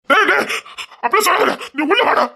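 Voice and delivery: high, urgent alarmed call; alarmed cry. Acted. Father stopping son from leaving, high alarmed call.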